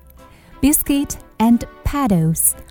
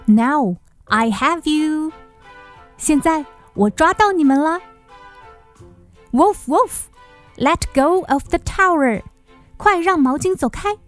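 put-on higher, playful little girl; playful catching; 7 semitones higher than usual. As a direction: catch them with a grin.

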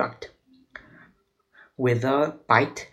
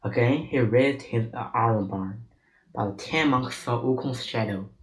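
usual speaking voice

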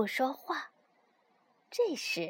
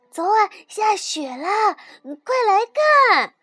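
much higher and louder, bursting with news; excited calling; 6 semitones higher, 9 dB louder than usual. A narrator's reading. The rabbit urges his sleepy friend to come see the snow, excited shout.

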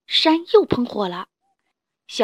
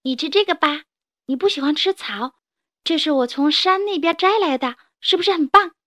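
put-on higher child voice, eager and proud; a kid showing off a gift to dad; proud little child; 5 semitones higher, 5 dB louder than usual. 小恐龙献果子. (小孩子骄傲地邀功)

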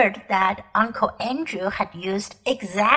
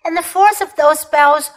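commanding, louder queenly voice; proud imperious queen; 2 semitones higher, 6 dB louder than usual. Acted. Queen boasting to the children of her war, imperious. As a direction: declaim it like a cold, proud empress.

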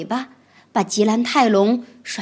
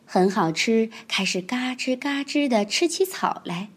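ordinary delivery

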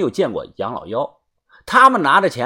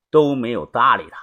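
usual speaking voice

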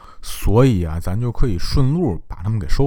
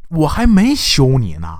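livid outburst; 7 semitones higher than usual. Retold or imitated. Duke raging about his remarried wife, livid and high. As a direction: fume at the insult, voice climbing. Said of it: "整个人更疯了".

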